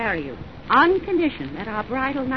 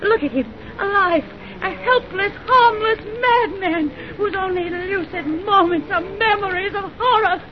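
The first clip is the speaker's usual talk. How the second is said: high, impassioned, near tears; bitter accusation; 6 semitones higher than usual. Acted. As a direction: throw the horror back at the culprit. Reveals maddened brother, accusing.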